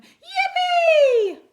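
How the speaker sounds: shrieking high joyful cheer; excited yippee. Acted. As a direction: scream it with delight. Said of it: "they cried".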